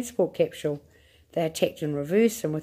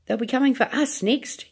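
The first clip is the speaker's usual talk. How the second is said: yelled; 7 semitones higher than usual. A narrator's reading. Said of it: "yelled Grandma Georgina".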